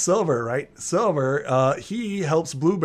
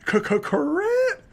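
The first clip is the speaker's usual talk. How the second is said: much higher, slow, stuttered through laughter; laughing correct. Real, not acted. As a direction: choke out the word mid-laugh. GM cracks up at the guess.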